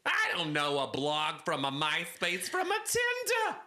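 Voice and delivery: high-pitched exclaiming caricature; Fran. Acted. Caller baffled by internet words, exasperated Fran voice. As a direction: squawk in comic exasperation. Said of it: "It's Fran!".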